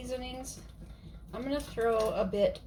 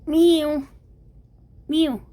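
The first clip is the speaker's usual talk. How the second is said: cat meow; 5 semitones higher, much slower than usual. Pretend. Calling the cat with meows.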